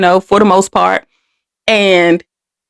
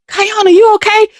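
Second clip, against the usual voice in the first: imitating concerned friends; 10 semitones higher, faster than usual. Mother's friends checking on the girl, in a higher worried voice. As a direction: call to the girl, worried and protective. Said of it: "Then they say".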